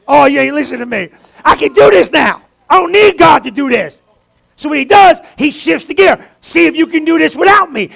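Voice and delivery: loud, high, climbing sermon shout; shouting the sermon home; full-force preaching. Acted. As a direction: preach it hard and build the room.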